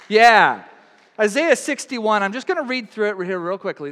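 ordinary delivery

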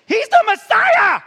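drawn-out, high-pitched hollering news flash; mock excited shout. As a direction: shout it out high and long, hammed-up. Mock breaking-news announcement.

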